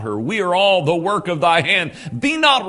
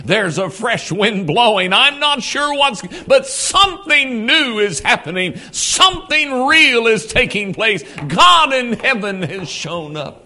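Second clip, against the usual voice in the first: louder, higher, eager exclamations; excited; 6 semitones higher, 5 dB louder than usual. Retold or imitated. People across the land telling of revival, excited. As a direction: announce the news with rising wonder.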